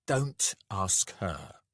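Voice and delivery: plain reading voice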